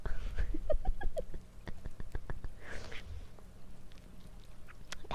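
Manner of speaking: long, very high giggling fit; real squeaky laughter; laughing. Real, not acted. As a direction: dissolve into helpless giggles. Loses it over repeated word.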